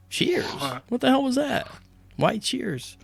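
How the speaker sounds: high, incredulous inner question; puzzled disbelief at the cheering; baffled surprise. Retold or imitated. Waking from coma, confused.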